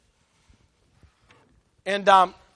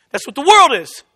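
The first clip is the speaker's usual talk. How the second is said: firm, louder declaration; emphatic declaration; 7 dB louder than usual. Real, not acted. Preacher sums up the world to the congregation, emphatic. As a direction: drive the line home with force. Same line said strongly, then softly.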